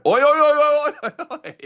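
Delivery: high, loud imitation of the oi chant; mock the co-host's hollering; exaggerated yell that falls away; mocking Aussie yell. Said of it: "and I never heard".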